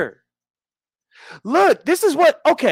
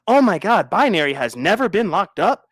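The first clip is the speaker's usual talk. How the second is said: usual speaking voice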